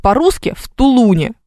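"Тулуне" stressed on the middle syllable ЛУ; correct stress. Shown as a demonstration. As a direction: hit ЛУ firmly in "Тулуне". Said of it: "это же неправильно".

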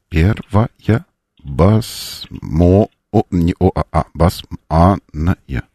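spelled out very slowly; slow dictation. Pretend. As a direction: drag it out like slow typing. Voicing what operator types.

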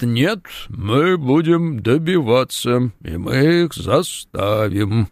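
very slow, measured official's delivery; Михаил Дегтярёв parody. Acted. Host parodies the sports minister's answer, slow measured impression. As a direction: drag each word out with weighty deliberation. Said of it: "может быть, не самая удачная пародия на Михаила Дегтярёва".

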